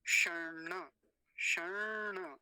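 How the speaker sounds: शर्ण with very long retroflex n; careful retroflex demo. Shown as a demonstration. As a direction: drag out shaṇṇ-ṇa in शर्ण for learners. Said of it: "ध्यान से सुन लीजिए".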